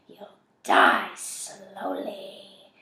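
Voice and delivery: slow, drawn-out sinister threat; menacing inner voice. A narrator's reading. Tormenting voice answering Alan inside Zach's head, sinister and slow. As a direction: stretch each word with menace.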